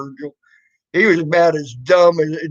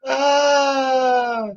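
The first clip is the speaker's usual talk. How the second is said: amused yelp; 7 semitones higher than usual. Real, not acted. Exclaiming at the troll.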